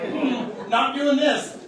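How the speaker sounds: a retold "nope, I'm out" with a touch of comic alarm; mock fed-up husband. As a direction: throw it off like a husband backing out of the room.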